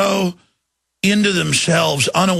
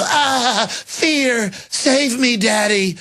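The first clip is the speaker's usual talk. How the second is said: much higher, childish fake plea; mock pleading fear; 7 semitones higher, much slower than usual. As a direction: beg like a hammed-up frightened child. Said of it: "the Republicans all just go".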